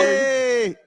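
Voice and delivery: a big high shout out to the crowd; shouted greeting. Real, not acted. Co-host shouting hello back to the live audience. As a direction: call it out to the whole room.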